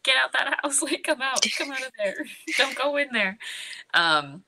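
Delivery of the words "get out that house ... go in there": mock screaming. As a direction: call out a warning in a hammed-up high voice, breaking into laughs. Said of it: "he's, like, screaming".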